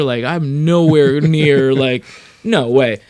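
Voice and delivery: loud, drawn-out refusal; retold incredulity. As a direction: stretch it out slowly and loudly, incredulous. Retold thought, overwhelmed by icons.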